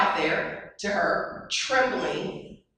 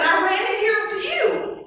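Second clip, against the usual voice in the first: put-on high little girl's voice; a small girl pleading her case; scared child; 12 semitones higher, 4 dB louder than usual. Child answering mother.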